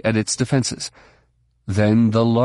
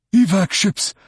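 urgent Duros voice; 9 semitones higher than usual. Acted. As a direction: shout the order to the fleet. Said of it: "a Duros voice".

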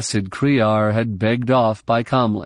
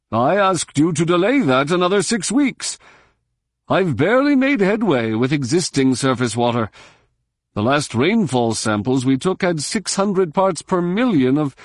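higher, aggrieved alien voice; Quarren official; 6 semitones higher than usual. A narrator's reading. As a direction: press your complaint hard. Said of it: "demanded Kala".

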